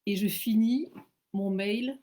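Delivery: ordinary talk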